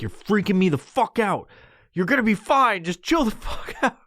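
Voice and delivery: high, strained telling-off; exasperated housemate. Retold or imitated. Housemate to the freaking-out stoner, exasperated. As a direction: plead and scold at a high pitch. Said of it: "somebody else in the house and they're like".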